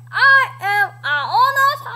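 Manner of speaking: high, loud garbled words; mangled dinosaur boast; Tyrannosaurus unable to talk. A narrator's reading. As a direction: roar words through a mouthful of feathers. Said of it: "He can't talk".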